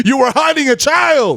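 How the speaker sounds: high, drawn-out accusing shout; hammed-up horrified reveal; mock horror. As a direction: stretch it out like a spook-house wail. Haunted-house bit continues.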